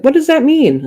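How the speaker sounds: loud, high-pitched incredulous question; baffled friend. Retold or imitated. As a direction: imitate a clueless friend, voice shooting up. Retells friend's clueless question.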